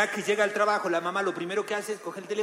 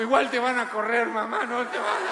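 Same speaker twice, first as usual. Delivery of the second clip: niño suplicando; 3 semitones higher than usual. Pretend.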